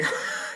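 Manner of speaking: quick burst of laughter; short laugh. Real, not acted. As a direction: laugh at your own joke. Laughing at his own joke.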